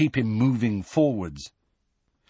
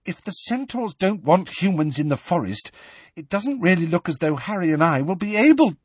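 put-on higher, anxious, breathless girl; 9 semitones higher than usual. A narrator's reading. Hermione panting along behind Hagrid, breathless. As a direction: hurry out a winded objection. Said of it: "said Hermione breathlessly".

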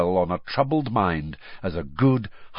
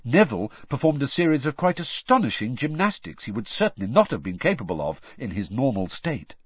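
ordinary delivery, nothing marked

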